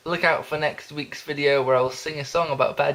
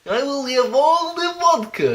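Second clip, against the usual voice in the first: much higher, hammed-up fake Russian accent; bad Russian accent; 12 semitones higher, 4 dB louder than usual. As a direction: order all the vodka in a badly faked Russian accent. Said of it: "in a really bad, unconvincing Russian accent".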